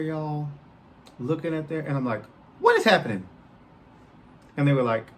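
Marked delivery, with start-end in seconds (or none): 2.6-3.3 loud, raised-pitch bewildered cry; baffled outburst. Retold or imitated. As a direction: call it out in comic disbelief.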